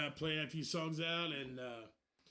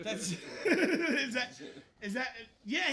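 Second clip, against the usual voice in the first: laughing; 7 semitones higher, 8 dB louder, slower than usual. Real, not acted. Laughing at the impression.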